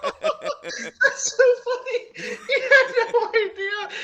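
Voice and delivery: high, slow, laughing through words. Real, not acted. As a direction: giggle the words out. Laughing at the story.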